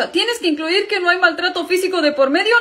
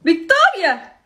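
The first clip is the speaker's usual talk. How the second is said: drawn-out outraged yell; 4 semitones higher, 4 dB louder, much slower than usual. Acted. Brother outraged at sister's threat.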